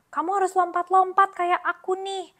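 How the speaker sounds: excited hopping call; bouncy animal. Acted. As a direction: urge him to hop along. Bouncy animal in children's story.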